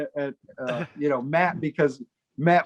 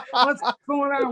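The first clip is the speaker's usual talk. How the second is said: feigned alarm; 8 semitones higher than usual. Retold or imitated. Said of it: "trying to play along".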